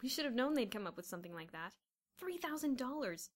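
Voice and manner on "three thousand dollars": high, slow, savoured sum. A narrator's reading. Boys gloating later over the prize, contained marvelling. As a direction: relish the sum without shouting.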